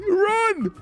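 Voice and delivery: usual speaking voice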